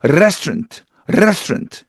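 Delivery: Italian-accented restaurant with a trilled r. Shown as a demonstration. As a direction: roll a long Italian r into restaurant. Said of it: "이렇게 혀가 굴러가거든요". (혀를 굴리는 이탈리아식 억양)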